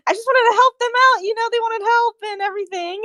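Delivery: high-pitched defensive whine; pleading excuse. Acted. [name] defending himself to Kira in the room, high pleading excuse. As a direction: justify yourself in a high voice.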